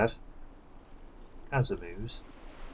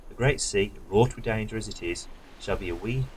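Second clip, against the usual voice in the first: lightly dramatic quest-giver reading, louder at the start; quest text read aloud; 5 dB louder than usual. Acted. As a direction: give the NPC's words a mild heroic sweep. Reads NPC quest text.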